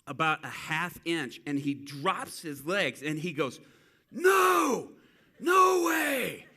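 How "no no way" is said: high, loud shout of disbelief; stunned shout. Retold or imitated. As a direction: yell it like you can't believe your eyes. Guy stunned his leg grew.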